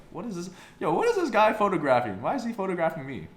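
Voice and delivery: high, loud, affronted questioning; mock indignant. Imagined passerby questioning a street photographer, indignant. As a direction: act out a baffled stranger objecting in a high voice.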